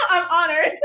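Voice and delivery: loud, high and laughing. Acted. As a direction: crack up while mock-honored. Joking along, laughing at the retelling.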